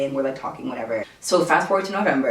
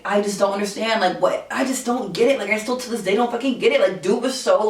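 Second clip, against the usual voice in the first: normal talking voice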